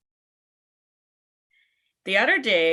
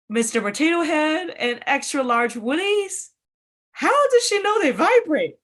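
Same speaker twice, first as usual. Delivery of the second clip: outraged disbelief; 8 semitones higher, 4 dB louder than usual. Real, not acted. Indignant rant about her mom.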